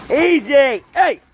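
high, sharp warning call. Real, not acted. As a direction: snap it out at the dog. Dog acting up again.

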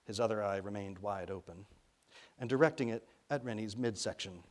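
plain reading voice

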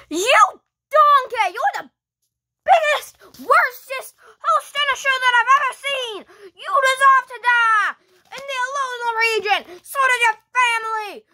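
high, hammed-up ranting insults; angry tirade. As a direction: tear into them with mock fury. Told to scare him, hurls insults.

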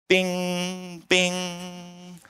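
quiet high mock monitor bing; imitated monitor beep. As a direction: mimic the monitor pinging slowly. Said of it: "the monitor is going bing".

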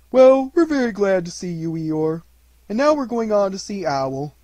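high, loud, hearty bear; cheerful Pooh. A narrator's reading. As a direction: greet heartily as a storybook bear.

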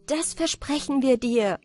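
the lovers call up their promise. Acted. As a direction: promise eagerly up the shaft. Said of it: "riefen die beiden".

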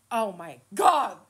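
ordinary talk, nothing marked

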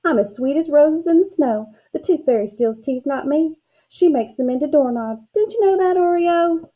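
high, singsong mock-innocence; taunting sister. A narrator's reading. Sister in the tree denying the theft, taunting. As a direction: tease with pitch climbing to the end.

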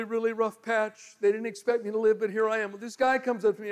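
normal talking voice

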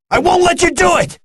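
high-pitched battle shout; defiant cry. Acted. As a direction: cry out to stop the foe.

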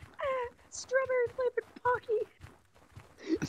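anime weeb voice. Shown as a demonstration. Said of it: "can you just say".

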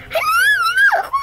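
high, loud, slow scream; panicked shout. Real, not acted. Gamer shrieks at players not to pick her, panicked. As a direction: squeal as if about to be picked.

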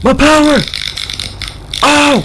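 very high, loud yells; outraged shouting. Real, not acted. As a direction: yell in outrage at losing it all. Enemy takes all power.

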